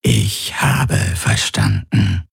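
very slow eerie whisper; whispery creature voice. A narrator's reading. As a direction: breathe it out slowly. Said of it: "seine Flüsterstimme".

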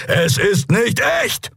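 high, slow, urgent call. A narrator's reading. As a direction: drive the words through to a friend in pain. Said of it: "rief er". (eindringlicher, gedehnter Ruf)

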